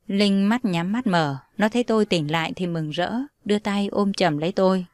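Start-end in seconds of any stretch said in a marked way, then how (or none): none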